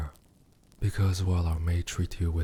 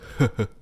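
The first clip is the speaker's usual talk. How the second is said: short laugh, high and louder; delighted laugh; 6 semitones higher, 5 dB louder than usual. Acted. Demon mocking the human's confused face, bright laugh. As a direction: laugh out loud at the captive's confusion.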